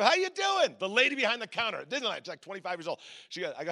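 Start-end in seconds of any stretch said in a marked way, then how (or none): 0.0-0.8 chirpy greeting. Retold or imitated. Cheerful greeting repeated.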